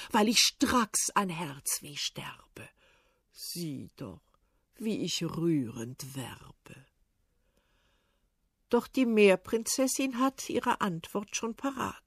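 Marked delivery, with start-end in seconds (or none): 0.1-6.9 low, slow, lovesick whisper; hushed, slow pleading of an old suitor; whispered old tsar. Acted. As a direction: breathe it out like a pining old man.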